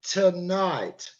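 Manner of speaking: tonight said smoothly, all five sounds joined; tonight blended. Shown as a demonstration. Tutor putting the sounds back together for a student. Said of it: "Put them together".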